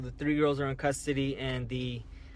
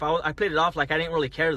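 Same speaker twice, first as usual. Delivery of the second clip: ordinary delivery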